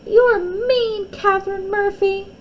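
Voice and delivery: high, loud tearful shout; a hurt child yelling after a fall; crying yell. Acted. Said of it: "she yelled".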